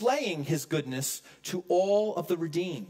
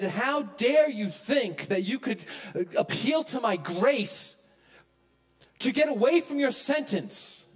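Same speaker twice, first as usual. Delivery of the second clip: stern judge's rebuke; 8 semitones higher than usual. Pretend. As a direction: hammer each word down from the bench. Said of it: "ripped into him and said".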